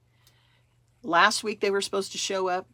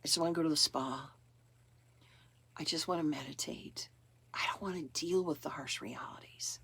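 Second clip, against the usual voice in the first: much softer, sinking into a whisper by the end; too worn out to bother, let the voice fade away; exhausted, almost whispered; tired and drained; 3 semitones lower, 9 dB quieter than usual. Retold or imitated. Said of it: "all of a sudden you're like".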